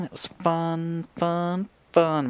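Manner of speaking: dry sarcastic repetition. Real, not acted. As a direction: say it like it was anything but fun. Creeper blew up the whole build.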